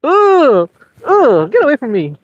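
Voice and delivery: loud high disgusted cry; grossed-out shriek at the fish; disgusted yell. Real, not acted. Recoiling from fish on stream.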